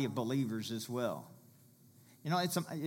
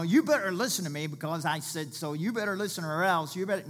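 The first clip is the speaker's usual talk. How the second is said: raised, louder scolding imitation; mock stern threat; 5 semitones higher, 5 dB louder, faster than usual. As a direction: lay down the law like an overbearing parent, acted. Mock threat he says God avoids.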